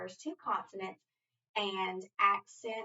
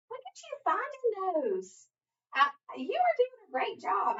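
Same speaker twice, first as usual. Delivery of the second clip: high, delighted teacher praise; gushing praise; 11 semitones higher, 3 dB louder than usual. Real, not acted.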